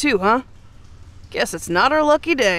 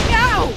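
sudden yelp of pain; 11 semitones higher than usual. Acted. Burned by the cell bars.

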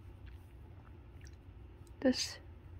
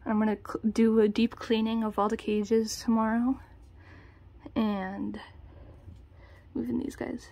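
usual speaking voice

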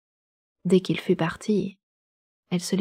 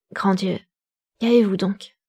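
alarmed exclamation; 3 dB louder than usual. A narrator's reading. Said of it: "s'écria Darcy avec plus de sympathie".

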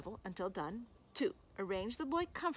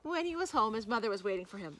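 plain reading voice

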